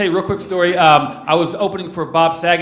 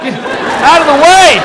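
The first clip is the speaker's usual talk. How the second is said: mock road rage; 6 semitones higher, 6 dB louder, slower than usual. Yelling at slow driver ahead.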